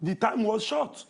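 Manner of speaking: slow, with "short" rising at the end; stress on SHORT. Real, not acted. Needling co-host who objects to it, stressing "short" with a rise. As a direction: stretch the line and push up on "short". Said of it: "You emphasizing on the word short".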